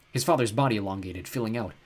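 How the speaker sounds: usual speaking voice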